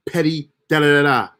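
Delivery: ordinary talk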